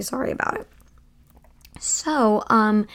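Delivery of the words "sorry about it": yawning, then a quick soft apology; stifled yawn through the words. Real, not acted. Up past midnight, telling listeners she's yawning. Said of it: "and I'm yawning".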